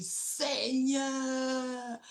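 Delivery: voix d'enfant haute et lente qui s'émerveille; cri de joie imité. Retold or imitated. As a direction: imite l'enfant qui remercie le ciel, aigu et étiré. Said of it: "il lève les mains au ciel et il crie". (cri de joie d'enfant imité, aigu et lent)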